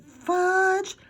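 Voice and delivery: loud, high angry shout of the name. A narrator's reading. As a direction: yell the boy's name in alarm. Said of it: "Then she shouted".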